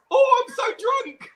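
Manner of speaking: high, drawn-out shout imitating a drunk fan; hammed-up tipsy wail, slow and high; mock drunken shout. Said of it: "there's a bit where he goes".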